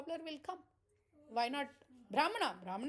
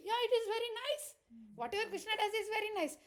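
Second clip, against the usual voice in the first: delighted cobbler; 7 semitones higher, faster than usual. Retold or imitated. Cobbler's delighted faith in story.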